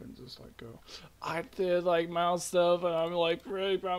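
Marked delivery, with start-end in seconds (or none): none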